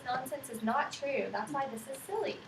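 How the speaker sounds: ordinary talk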